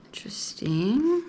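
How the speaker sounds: intrigued whisper; whispered interest. Real, not acted. Reader reacting to a drawn card, intrigued whisper. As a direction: breathe it out, curious.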